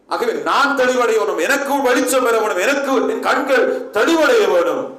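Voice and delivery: loud, high, driving pulpit delivery; forceful preaching. Acted. Preacher rousing his congregation, loud and high. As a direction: hammer each line home to the church.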